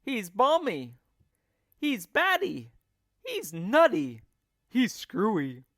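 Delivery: high, loud jeering voices; each animal calls him crazy; mocking cries. A narrator's reading. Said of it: "they cried".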